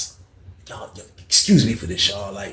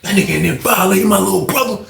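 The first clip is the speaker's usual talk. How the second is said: angry; 7 semitones higher than usual. Retold or imitated. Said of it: "He like".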